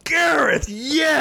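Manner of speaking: hammed-up lover calling out a name; mock ecstatic lover. Lover crying out to namesake in bed, mock ecstatic.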